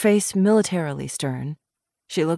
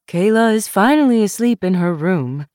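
put-on upcountry Southern accent; 3 semitones higher, 6 dB louder than usual. A narrator's reading. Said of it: "said Missus Foster".

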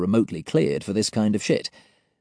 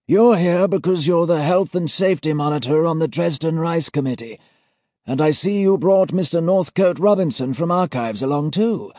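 put-on high, loud ceremonial old gent; formal old auditor; 8 semitones higher, 5 dB louder than usual. A narrator's reading. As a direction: pronounce the seal with cold authority. Senior auditor figure.

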